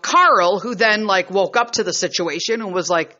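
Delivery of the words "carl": loud call of a name; yelled name. Retold or imitated. Mother yelling to her husband across the grotto. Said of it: "that's when I yelled".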